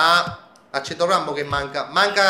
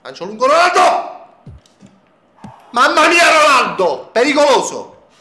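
yelling at the match, much higher; excited shouting; 8 semitones higher, 8 dB louder than usual. Real, not acted. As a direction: shout the play as it happens. Live football game commentary shouting. (urla eccitate)

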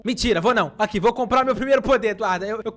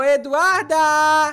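calling out; 3 semitones higher, 4 dB louder, much slower than usual. Real, not acted. Calling his sister from afar.

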